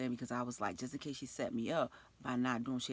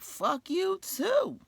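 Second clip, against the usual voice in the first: higher, louder, very slow fuck you too; drawn-out retort; 9 dB louder, much slower than usual. Retold or imitated. Narrator firing back at the threatening coworker, loud drawn-out retort. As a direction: drag it out in her face.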